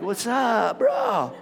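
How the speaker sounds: skater greeting swooping up high. Retold or imitated. Pastor mimicking how his friend always talks, high swooping impression. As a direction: imitate a skater bro, pitch leaping up. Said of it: "that's how he talks".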